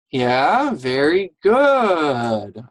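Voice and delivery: loud, drawn-out teacher praise; enthusiastic praise. Real, not acted. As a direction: cheer the class, stretching the words. Teacher praising pupils after reading.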